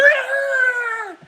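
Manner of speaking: high, shouted yelp of fright; high frightened scream; startled scream. Retold or imitated. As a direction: yelp like you just got shot at. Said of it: "He's like".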